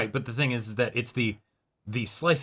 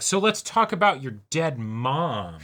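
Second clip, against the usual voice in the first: slow, louder, blunt; mock tactless question; lightly voiced Fuko, slow and loud; blunt Fuko quote; 5 semitones higher, 6 dB louder than usual. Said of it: "would come along and just be like".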